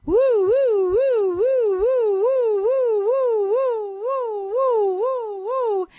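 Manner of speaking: slow high wavering woos; slow sing-song whooping; mojo whoops. Real, not acted. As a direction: draw out high wailing woos. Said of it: "leave you with a little more mojo".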